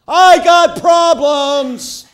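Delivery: long, high, shouted cry. Acted. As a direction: belt it out slowly, very high. Flag that lets everybody know.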